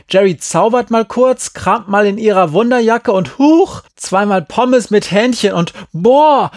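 high, loud, mocking tirade; sarcastic rant. A narrator's reading. Girl mocks being expected to conjure food and beds, sarcastic shouting. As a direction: rant with exaggerated fake wonder. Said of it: "rief Jerry".

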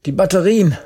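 pushed out high on a panting breath; gasping. A narrator's reading. Narrator winded after Jerry's punch, gasping. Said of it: "keuchte ich".

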